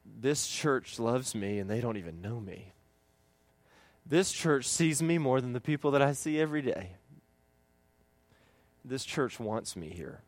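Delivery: quietly grateful thought; moved visitor. Retold or imitated. Visitor's inner voice.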